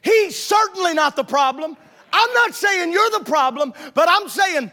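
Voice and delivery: loud, high, slowed exhortation; full-force preaching. Real, not acted. Preacher pressing the congregation to face their problem, loud. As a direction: drive the point home from the pulpit.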